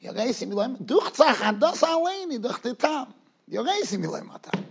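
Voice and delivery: soft, refined imitation of Rabbi Yankov; imitating posh rabbi. Rabbi recalls how Rabbi Yankov recited it, posh and soft. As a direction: recall his elegant, hushed delivery. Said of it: "very posh".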